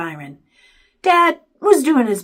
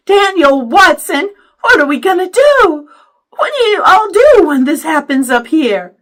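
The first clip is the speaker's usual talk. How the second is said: loud, high Southern fretting; worried Southern accent; 8 semitones higher, 11 dB louder than usual. A narrator's reading. Worried mother demands answers from father, Southern accent. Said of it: "talking Southern style when she got worried".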